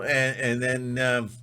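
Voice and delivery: normal talking voice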